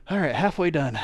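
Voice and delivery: winded after the effort; out of breath. Real, not acted. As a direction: speak while catching your breath. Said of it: "Gotta catch my breath".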